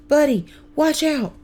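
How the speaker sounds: high, urgent shout; yelling warning. A narrator's reading. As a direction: warn a frozen little brother. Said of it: "and then yells".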